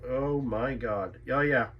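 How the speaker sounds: ordinary delivery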